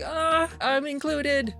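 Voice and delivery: high, slow, pleased; delighted. Retold or imitated. Guest reacts to being called ladies, high and pleased. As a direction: savor being included. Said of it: "it's just like".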